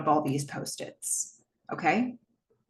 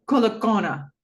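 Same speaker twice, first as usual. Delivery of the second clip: a hint of a Hawaiian accent; 2 semitones higher, 4 dB louder than usual. [name] saying his name translated to Jo, light Hawaiian accent. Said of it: "puts on a, a Hawaiian accent".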